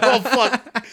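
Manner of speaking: high, loud, laughing oh fuck; laughing curse. Retold or imitated. Tree on the truck, laughing high curse. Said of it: "I start laughing harder".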